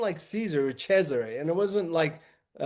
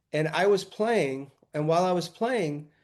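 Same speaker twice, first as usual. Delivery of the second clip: usual speaking voice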